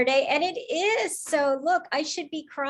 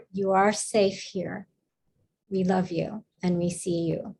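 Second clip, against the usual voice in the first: tender reassurance; 4 semitones lower than usual. Real, not acted. Host tells shaken student they are loved, tenderly.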